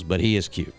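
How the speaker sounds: ordinary delivery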